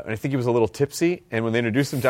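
normal talking voice

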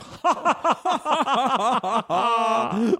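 long, high villainous cackle; German evil laugh. Acted. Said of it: "that's his German evil laugh".

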